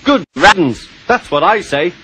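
a sneering Good riddance, voiced high; high, scornful engine voice. Engine scoffing at the Fat Controller's decision, high and scornful.

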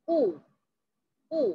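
usual speaking voice

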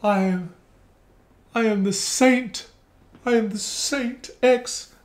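high, slow, self-righteous saint voice; slow mock-saintly proclamation. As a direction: preach your own sainthood in a lofty sing-song, mocking her. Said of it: "this holier than thou".

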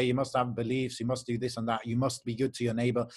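ordinary talk, nothing marked

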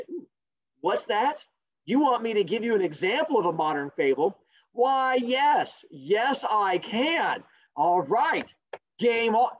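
hammed-up agreement to a request; mock eager response. Teacher pretends the class begged for an example, mock eager.

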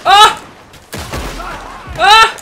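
loud, high startled cries; startled yelp. Real, not acted. Player startled in a gunfight, startled yelp. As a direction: yelp in sudden surprise.

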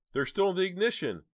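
very high, loud cry; frantic high-voiced exclamation; urgent cry. A narrator's reading. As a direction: blurt it out in high-pitched alarm. Sudden recall during frantic escape.

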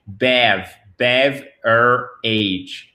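beverage split into separate pieces; teacher sounding out beverage in chunks; syllable-by-syllable breakdown. Shown as a demonstration. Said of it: "c'est beverage".

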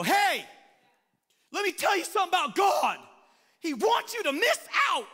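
shouted, pitched high and fast; loud blunt Satan. Pretend. Satan barging up to Eve, shouting openly. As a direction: blurt the temptation out loud.

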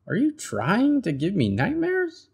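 high, hammed-up outrage; dramatic Bird. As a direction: play the drama queen. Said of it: "said Bird".

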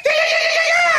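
very high squealing yeahs; high excited yeahs. Real, not acted. Fan squealing over beating the Saints. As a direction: shriek them with joy.

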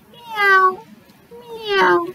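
cat meowing. A narrator's reading. Reading to a child, meowing as the cat. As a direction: mew like a little storybook cat. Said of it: "said the cat".

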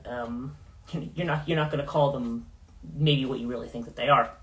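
ordinary talk, nothing marked

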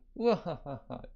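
put-on laugh in falling bursts; acted belly laugh. Reacting to the baby's name, acted laugh. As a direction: laugh it out in a string of ha-ha-has.

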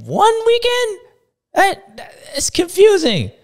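high and loud, drawn out; excited squeal. Real, not acted. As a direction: gush with disbelief. Excited over Sexton's title.

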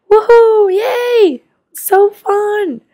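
high, loud squeals of joy; excited kid cheer. Acted. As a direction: cheer like a thrilled child. Kids cheer at trip news.

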